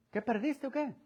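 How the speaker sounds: soft, sneering mock question; mocking taunt. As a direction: taunt him as if you didn't know. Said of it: "Es una manera burlona de decir". (pregunta burlona y suave)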